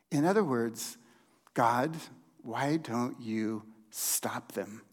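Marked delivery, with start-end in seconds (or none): 1.5-4.8 low, slow, softened plea to God; quiet frustration. Acted. Pastor voicing Habakkuk's plea to God, low and slow. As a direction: let the frustration sink into a quiet ask. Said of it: "can you hear the frustration in Habakkuk's voice".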